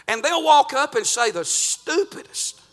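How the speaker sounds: "stupidest" leaned on hard; stress on stupidest. Real, not acted. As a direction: punch "stupidest" as the key word. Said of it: "I'm emphasizing as much as I can".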